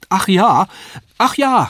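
put-on high, loud eager boy; eager cry. A narrator's reading. The boy eagerly agrees, high and excited. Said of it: "rief ich eifrig".

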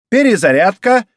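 high, loud drill order; shouted command. A narrator's reading. Girl orders her snowball squad, shouted command.

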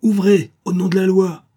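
booming order of the law; loud command. A narrator's reading. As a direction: bang out the order at the door. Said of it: "une voix forte criait".

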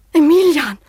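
high, loud outcry of his name; cry of recognition. Acted. Daughter suddenly recognizes her lost fiancé, a high startled cry.

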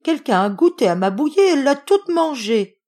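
put-on high, loud baby bear; little bear's loud, high complaint; tiny crying-out voice. A narrator's reading. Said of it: "cria bébé ours de sa toute petite voix".

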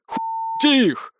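high, drawn-out cry; ploughman's yell. A narrator's reading. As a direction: urge the mob to attack. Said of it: "заорал пахарь". (протяжный высокий крик)

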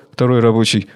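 ordinary talk

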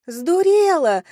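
high, loud, drawn-out yell; shouting. Acted. Soaked Kelsey yells up at window, shouting. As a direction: yell it up in tipsy outrage. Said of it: "крикнула Келси вверх".

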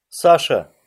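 loud, high desperate call; shout. A narrator's reading. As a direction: cry the name out from behind. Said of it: "ударил сзади крик".

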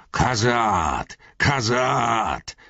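high, ringing war cry of a dwarf; dwarf battle cry. A narrator's reading. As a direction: bellow the clan cry into the fight. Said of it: "Mit seinem schaurigen Schlachtruf".

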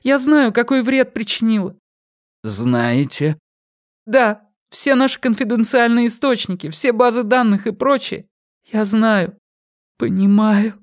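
high, tearful confession; after crying. A narrator's reading.

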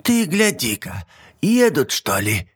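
put-on high cackling old hag; old witch voice. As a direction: play a fussy old witch. Baba Yaga's voice in story.

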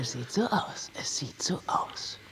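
tonloses, leises Flüstern, beim zweiten Mal langsamer; geflüstert. Acted. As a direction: hauch es nur, ohne Stimme. Lawyer answers grimly about world. (Anwältin flüstert dem Häftling resigniert zu)

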